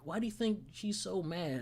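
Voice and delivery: ordinary talk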